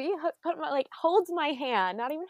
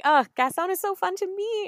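higher, louder gushing sigh; gushing fan; 8 semitones higher, 5 dB louder than usual. Retold or imitated. Fan gushing about Gaston to the teenagers, higher and louder. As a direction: swoon over meeting Gaston. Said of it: "and I was like".

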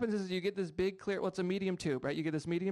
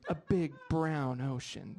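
mock sensual; 4 semitones lower, slower than usual. Husband trying sweet talk on his wife, mock sensual. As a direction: lay on the romance like a bad soap. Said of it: "like, sensual".

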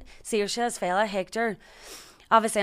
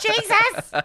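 laughing yelp; 8 dB louder than usual. Pretend. Imagined crash reaction.

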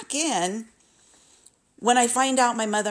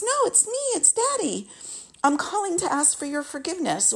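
much higher, coaxing imitation of dad; high mock Daddy voice; 9 semitones higher than usual. Imitating dad pleading on the phone for forgiveness, much higher. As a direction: squeak it like a wheedling father. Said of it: "He goes".

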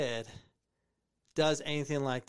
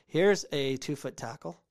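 normal talking voice

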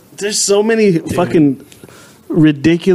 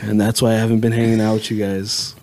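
earnest confession; 9 semitones lower, 5 dB quieter, faster than usual. Retold or imitated. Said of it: "He's like".

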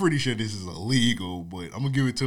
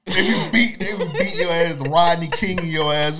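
loud; 7 semitones higher, 9 dB louder than usual. Retold or imitated. Heated rant about cops beating.

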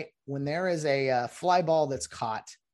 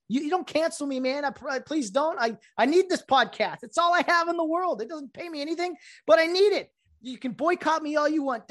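high, hammed-up begging to listeners; mock pleading; 12 semitones higher, 5 dB louder than usual. Podcast host jokingly begs listeners not to cancel him, high and pleading. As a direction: beg the audience, half joking.

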